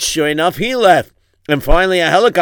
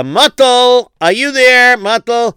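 calling out; 8 semitones higher, 5 dB louder, slower than usual. Real, not acted. Teacher calls a child.

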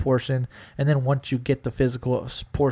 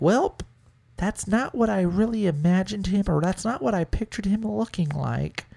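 mock hesitant girl; 6 semitones higher than usual. Girl doubting after meeting.

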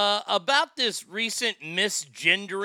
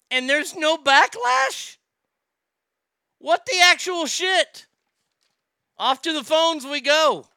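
raised, indignant and loud; outraged; 7 semitones higher, 5 dB louder than usual. Real, not acted. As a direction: let the outrage burst out. Outraged rant about backlash.

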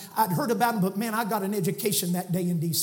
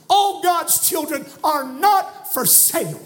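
slow, louder and higher; emphatic proclamation; 6 semitones higher, 6 dB louder, slower than usual. Real, not acted. Preacher quoting the movie line to the crowd, slow and loud. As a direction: proclaim it slowly.

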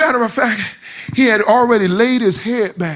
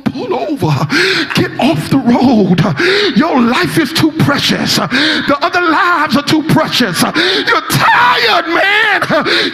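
pleading with the driver; 8 semitones higher, slower than usual. Retold or imitated. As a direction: beg the tired driver to pull over. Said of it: "I told him".

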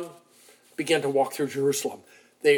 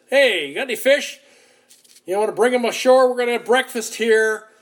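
raised, higher call across the water; 7 semitones higher, 6 dB louder than usual. Retold or imitated. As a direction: call out to the boat from the shore. Stranger calls from the beach.

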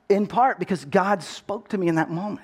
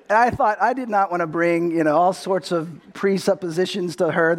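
normal talking voice

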